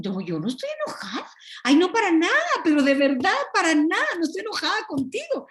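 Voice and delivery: mock sugary insistence that all is fine; insistent fake denial. Mimicking denial of being angry.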